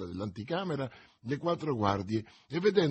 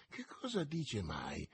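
muttered; 11 dB quieter than usual. A narrator's reading. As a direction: mutter it under your breath. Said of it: "borbottò Porthos". (borbottato sottovoce)

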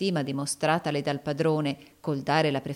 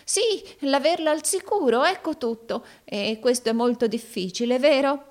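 high-pitched, worried questioning; anxious; 8 semitones higher, 4 dB louder than usual. A narrator's reading. Arturo asking Rodolfo about guarding the bride, anxious. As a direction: seek reassurance in a high, uneasy voice.

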